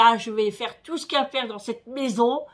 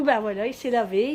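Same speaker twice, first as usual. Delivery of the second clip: ordinary delivery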